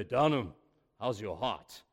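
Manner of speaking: low, a hint of a gruff old pastor's growl; growling old mentor. Mentor phoning the young pastor, low mild growl. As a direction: lightly growl it like a big stern man. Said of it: "when he spoke, he growled".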